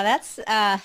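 usual speaking voice

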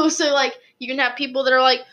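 ordinary talk, nothing marked

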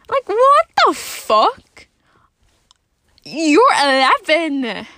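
real high, loud, drawn-out scream of disbelief; outraged screaming. Real, not acted. Alone in the car ranting about nudes, screaming in outrage. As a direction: scream it out slowly, appalled. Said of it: "sitting in my car screaming".

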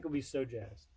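normal talking voice

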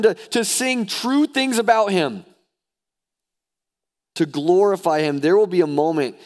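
high, loud preacher exhortation; rousing, urgent sermon delivery; impassioned preaching. Real, not acted. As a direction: preach it at full force, pitched well up. Preaching at full force.

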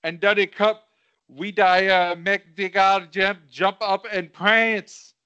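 rapped verse in a put-on Jamaican accent; rhythmic amateur Jamaican patois. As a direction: chant it with a mock Jamaican accent. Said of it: "Do I have to do a Jamaican accent?".

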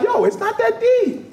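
high, breezy shrug-off; casual it's no big deal; dismissive self-talk. Retold or imitated. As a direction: brush the worry aside. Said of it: "And I was like".

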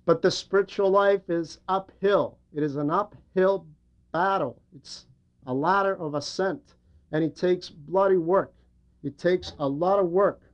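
normal talking voice

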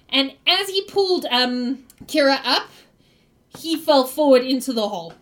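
normal talking voice

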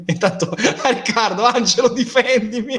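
laughing plea for help. Real, not acted. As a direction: ask for backup while laughing. Laughs asking for defence. (supplica ridendo)

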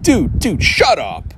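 high, scoffing brush-off; fed up with the YouTube guy; mock dismissive. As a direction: cut the explainer off with scorn. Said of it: "And I'm like".

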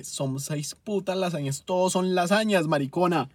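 loud, exasperated outburst building in force; enraged. Real, not acted. Customer fuming at waitress over six lasagnas, enraged. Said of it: "nunca me habían sacado tanto la rabia".

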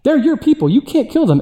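Moses pleading. Retold or imitated. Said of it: "Moses ... told God".

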